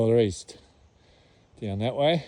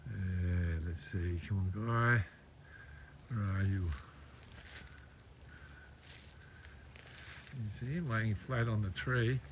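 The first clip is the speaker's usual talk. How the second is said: hushed voice; 10 dB quieter than usual. Real, not acted. Creeping up on a goanna.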